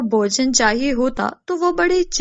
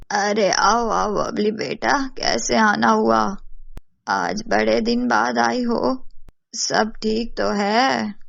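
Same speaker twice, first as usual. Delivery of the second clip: welcoming village mother; 2 semitones lower, slower than usual. Acted. Mother greets neighbour Babli.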